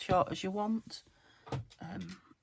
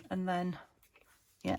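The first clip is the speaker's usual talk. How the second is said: ordinary talk, nothing marked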